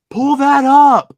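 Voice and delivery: high, very loud, drawn-out shout; imitated yelled order. Storyteller recalls woman he'll never forget, yelling an order. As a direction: bellow it slowly as a mock command.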